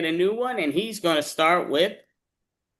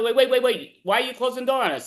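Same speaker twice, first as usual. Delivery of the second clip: high, hurried mock alarm; urgent protest; 7 semitones higher, faster than usual. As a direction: rush it out, objecting in character. Matadors protest being shut out.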